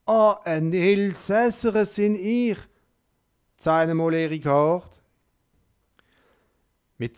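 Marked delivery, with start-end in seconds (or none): 0.0-5.0 hoch, laut und langsam auf Elsässisch; elsässischer Dialekt. A narrator's reading. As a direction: sprich jetzt wie sie, elsässisch. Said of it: "in dem nämlichen Spruch wie sie". (Petrus antwortet im elsässischen Dialekt)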